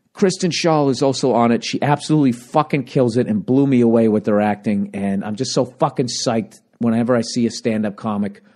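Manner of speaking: ordinary talk, nothing marked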